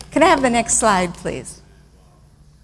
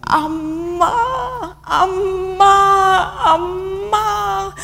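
high, drawn-out wail for mother; plaintive acted calling, each word stretched; imitated child's cry; 8 semitones higher, much slower than usual. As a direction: cry out from the pit of the stomach. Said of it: "he cried from the pit of his stomach".

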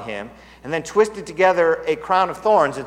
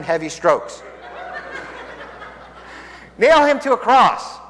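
rising, louder indignant read; a pastor reading a sarcastic advice-column answer, building to a near shout; mock advice-column reply; 4 semitones higher, 3 dB louder than usual. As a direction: hammer each brutal step home, louder and higher. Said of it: "Letter to an advice column".

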